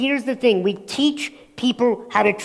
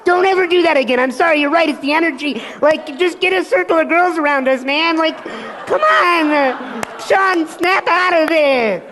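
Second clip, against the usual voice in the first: higher, louder frantic pleading; freaking out; 6 semitones higher, 5 dB louder than usual. Retold or imitated. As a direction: beg him frantically to snap out of it. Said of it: "he's, like, freaking out".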